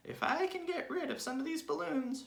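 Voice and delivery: put-on very high scheming old woman; old hag voice. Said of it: "she said to herself".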